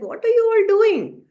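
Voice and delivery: high, loud astonished question; amazed cry. Acted. Naradji marvels at the tiny birds, high and loud. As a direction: cry out in wonder at the sight.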